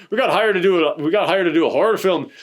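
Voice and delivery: hammed-up Irish filmmaker; Irish accent. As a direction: put on a comic Irish accent. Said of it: "we gotta do my Irish accent".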